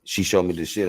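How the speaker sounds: ordinary delivery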